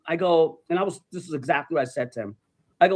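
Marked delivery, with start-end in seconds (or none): none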